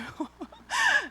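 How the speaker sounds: a sudden high, loud burst of laughter. Real, not acted. Mother laughing at her daughter's wish, bursting out high.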